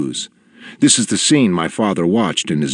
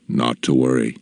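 put-on a gentle old man's reassurance; reassuring; 7 semitones lower than usual. A narrator's reading. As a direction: put the boy's mind at ease. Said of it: "in a way that made me believe him".

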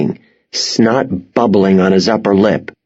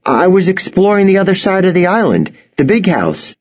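higher, innocent-sounding explanation; nothing to hide; 10 semitones higher than usual. A narrator's reading. Boy gives farmers his cover story, trying to sound innocent. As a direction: say it as if you have nothing to hide. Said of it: "I tried to sound like I had nothing to hide".

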